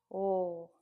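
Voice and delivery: "o" held as one sustained phoneme; isolated letter sound. Shown as a demonstration. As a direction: sound out "o" alone, drawn out. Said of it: "So the first sound".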